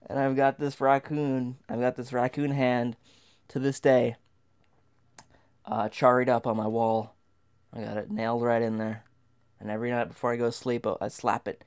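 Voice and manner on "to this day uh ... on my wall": usual speaking voice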